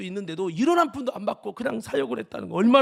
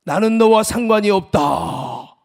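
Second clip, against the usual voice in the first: God's voice; 11 dB louder, slower than usual. Retold or imitated. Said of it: "하나님의 음성이 뭐라고 하냐면".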